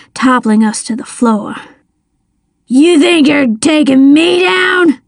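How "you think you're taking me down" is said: slow, high, strained challenge; villain's raised, defiant cry; angry shout. A narrator's reading. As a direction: shout it as a drawn-out threat. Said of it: "he shouted".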